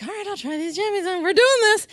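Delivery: high, quick go-along cheer; forced cheer. Retold or imitated. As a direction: psych yourself up to comply. Psyching herself up to comply.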